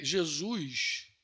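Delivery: Jesus said with drawn-out awe; slow reverent pause. Acted. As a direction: draw out Jesus very slowly. Solemn naming of Jesus.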